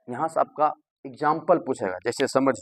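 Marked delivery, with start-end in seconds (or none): none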